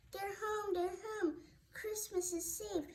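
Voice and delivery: high, excited cry of relief; joyful they're home. Acted. Pets return on Christmas.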